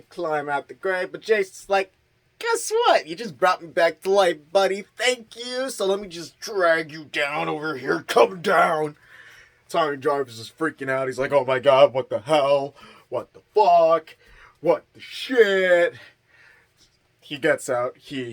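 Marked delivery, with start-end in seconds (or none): 2.4-9.0 high, loud put-on monster voice, fast at first; mock Jason voice. Jason taunting Tommy in the grave, hammed-up villain voice. 11.3-15.9 loud, rising hammed-up panic; mock panic. Tommy panicking as Jason returns, loud mock panic.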